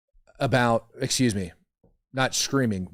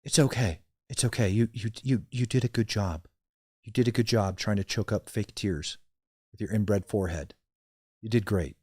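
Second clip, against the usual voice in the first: softer, sarcastic fake reassurance; mock comforting; 3 semitones lower, 6 dB quieter than usual. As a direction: pat her on the head with mock sympathy. Streamer mock-comforting the crying witness.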